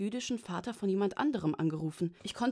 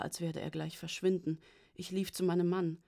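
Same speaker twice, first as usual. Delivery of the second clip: ordinary delivery, nothing marked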